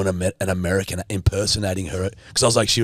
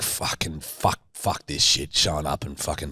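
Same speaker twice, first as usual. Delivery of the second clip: grumbling under breath; 6 semitones lower, 6 dB quieter than usual. Retold or imitated. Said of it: "So I was like".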